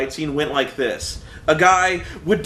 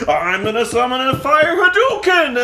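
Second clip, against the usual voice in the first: higher, louder cheesy battle boast; hammed-up summoning cry; 7 semitones higher, 4 dB louder than usual. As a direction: announce the attack like a corny cartoon fighter. Mocking the Earth fighters' lines.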